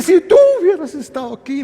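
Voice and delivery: high-pitched mock lament of a grieving sister; acted reproach. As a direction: plead it high, as if imitating her complaint. Martha's reproach to Jesus acted.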